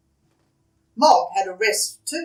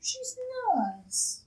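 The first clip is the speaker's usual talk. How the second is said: put-on little girl voice; 14 semitones higher, 10 dB quieter, much slower than usual. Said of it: "And Debbie said".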